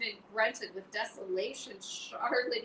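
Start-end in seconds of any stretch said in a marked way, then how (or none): 1.8-2.6 moaning. Acted. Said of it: "he moaned".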